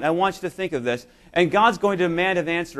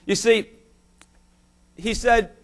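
ordinary delivery

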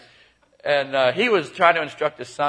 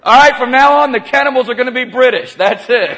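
loud, high mock announcement; raised, hammed-up proclamation; mock decree; 12 semitones higher, 15 dB louder than usual. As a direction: declare it loudly over the laughter. Loud mock decree over audience laughter.

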